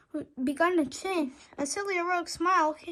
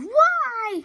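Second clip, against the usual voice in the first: desperate cry; 7 semitones higher, 6 dB louder than usual. A narrator's reading. Harold crying out.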